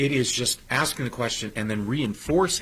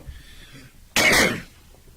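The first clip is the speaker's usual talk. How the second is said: dry cough mid-sentence; 4 dB louder than usual. Real, not acted. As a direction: cough to clear throat. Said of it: "I got a frog in my throat".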